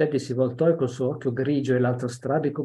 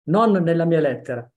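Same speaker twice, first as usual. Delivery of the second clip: loud refusal; 4 semitones higher, 7 dB louder than usual. Acted. Child refusing loudly.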